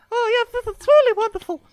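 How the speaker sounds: squeaky helium-high character voice. Acted. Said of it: "we turned on the helium".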